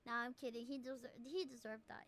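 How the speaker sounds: ordinary talk, nothing marked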